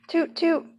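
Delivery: high, bright hoot like a horn; train horn toot. A narrator's reading. Pete honking the train horn, high imitated toot.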